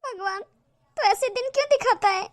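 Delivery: put-on sobbing prayer of a woman who cannot have a child; crying to God. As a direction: weep it out, asking God why. Elder daughter-in-law weeps alone.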